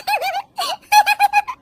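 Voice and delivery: laughing high at the funny game; high happy laugh. Acted. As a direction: let out a high giggle.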